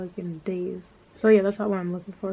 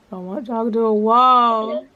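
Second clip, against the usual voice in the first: higher, louder and slow, stretching out wall; exasperated louder repeat; 4 semitones higher, 7 dB louder than usual. Retold or imitated. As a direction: belt it out like you're fed up. Repeats complaint, second time louder.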